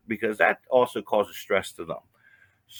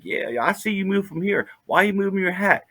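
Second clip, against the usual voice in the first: higher-pitched mock holler; retold hollering; 7 semitones higher than usual. Woman hollering at a man she sees moving, mild mock holler. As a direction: imitate her challenging someone, pitch raised. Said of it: "she's hollering at him".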